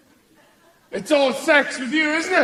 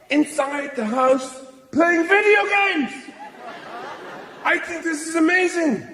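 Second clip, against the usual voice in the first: ordinary talk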